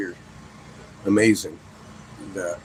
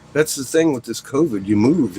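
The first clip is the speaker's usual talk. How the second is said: ordinary talk, nothing marked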